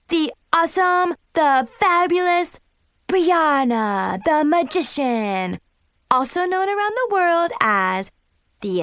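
high, slow booming showman announcement; pompous senator. A narrator's reading. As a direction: boom it out like a grand ringmaster. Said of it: "She announced in a booming voice".

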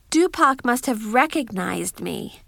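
ordinary delivery, nothing marked

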